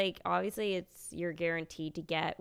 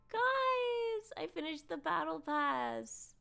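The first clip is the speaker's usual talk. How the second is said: gasping, much higher squeal of delight; gasp, then squeal it out to the chat; real breathless excitement at the win; thrilled gasp; 14 semitones higher, slower than usual. Real, not acted. Gasps at finishing the battle pass.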